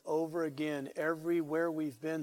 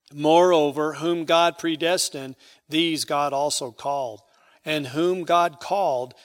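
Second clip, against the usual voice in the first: loud, ringing pulpit cadence, hitting each God; booming scripture read from the pulpit; preaching at full force; 7 dB louder than usual. Acted. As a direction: proclaim it to the back pews. Preaching the Romans passage loudly.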